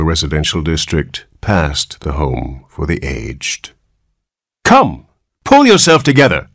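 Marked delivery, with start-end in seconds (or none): 4.6-6.5 loud brisk command. Acted. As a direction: snap him out of it. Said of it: "commanded the nurse".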